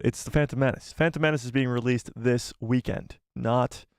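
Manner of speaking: ordinary talk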